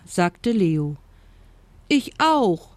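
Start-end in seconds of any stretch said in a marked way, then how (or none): 1.9-2.7 put-on high little child-animal voice; baby hippo. A narrator's reading. Hippo baby wants to play too, little voice. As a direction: chime in eagerly like a small child. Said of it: "sagte das Flusspferdbaby".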